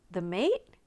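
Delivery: plain reading voice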